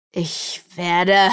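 threatening, put-on demon voice; menacing demon threat. A narrator's reading. Enraged demon about to strike, menacing. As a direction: begin a furious threat.

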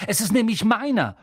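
put-on high, loud indignant boy; furious. A narrator's reading. Boy insists the letter is his, furious. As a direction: claim it angrily. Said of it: "sagte Harry wütend".

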